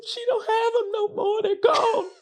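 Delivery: high, wailing mock sobs; hammed-up bawling. Reenacting herself at a stranger's funeral, hammed-up bawling. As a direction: bawl it out like an over-emotional mourner. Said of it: "I would be in there bawling".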